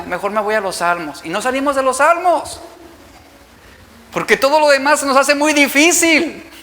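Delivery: normal talking voice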